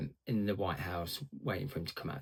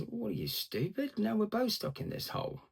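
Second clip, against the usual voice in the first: much higher, exasperated disbelief; what, are you mad, pitched way up; incredulous retort; 13 semitones higher than usual. Retold or imitated. Stuck man scolds friend who jumped in, incredulous.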